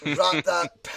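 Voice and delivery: put-on cut-short Scottish accent bit. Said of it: "good Scottish accent for Brucey boy".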